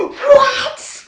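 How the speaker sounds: gasp, then a high loud shocked cry; hammed-up outrage, way up high; dramatic shock. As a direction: overplay the disbelief with a sharp gasp. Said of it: "overly dramatic".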